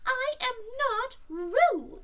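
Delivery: slow, very high squeaky protest; loud. Acted. Piglet protesting to Kanga, loud and high. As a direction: squeal each word out loudly. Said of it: "said Piglet loudly".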